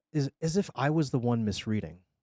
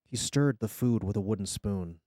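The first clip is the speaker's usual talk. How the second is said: usual speaking voice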